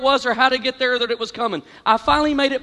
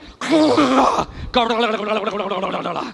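gargling noise; 3 semitones lower than usual. Retold or imitated. Mimics gargling under water.

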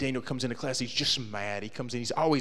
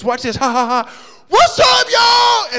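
high, loud whoop at the bus; yelled taunt; 13 dB louder, much slower than usual. Retold or imitated. Eric hollering at the passing school bus, loud and high. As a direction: holler it out the Jeep like a reckless teen.

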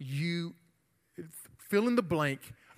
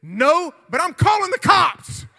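high yelled threat, peaking at the end; retold panicky bluster at full volume; re-enacted frantic shout; 10 semitones higher, 12 dB louder than usual. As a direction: shout a comeback at a bully. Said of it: "Just like that".

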